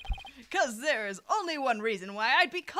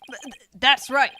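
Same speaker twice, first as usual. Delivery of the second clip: flustered stammer; 2 semitones higher, 10 dB louder than usual. Acted.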